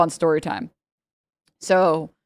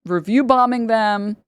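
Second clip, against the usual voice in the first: ordinary delivery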